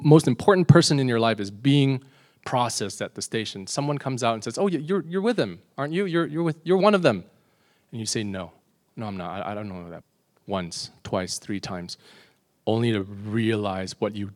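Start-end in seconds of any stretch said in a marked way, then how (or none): 4.6-7.2 higher-pitched, stammering recognition; excited accuser picking out a suspect; acted accusation. Imagined stranger accuses the listener. 8.2-10.0 quiet, lower-pitched denial; soft, hesitant disowning; meek denial. Acted. Imagined meek denial of friend.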